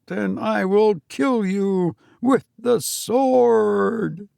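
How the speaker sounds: high, slow pulpit bellow, drawn out; a minister booming scripture from the pulpit; preacher bellowing. A narrator's reading. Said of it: "when he bellowed".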